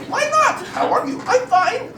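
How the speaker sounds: put-on very high, loud German accent; hammed-up German tribesman; German tribe member. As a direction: pipe up with a squeaky German accent. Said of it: "it was German tribes they were talking about".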